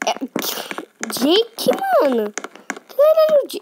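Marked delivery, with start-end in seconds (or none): 2.9-3.6 loud high startled question; shocked squeal. Real, not acted. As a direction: squeal in disbelief. Reação chocada no jogo. (grito de surpresa)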